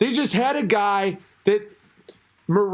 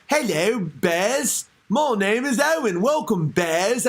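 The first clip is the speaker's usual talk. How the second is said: hammed-up British robot voice; exaggerated British greeting, stretched at the end; mock British accent; slower than usual. Host imagining his own voice machine, mock British accent. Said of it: "my fucking robot voice to be British".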